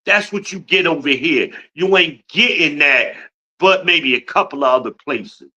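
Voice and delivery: ordinary delivery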